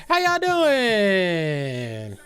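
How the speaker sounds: crawl through How y'all doing? extremely slowly, falling at the end; milk every syllable of the hello; very slow drawn-out greeting. Pretend. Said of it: "I feel like I have to talk like this".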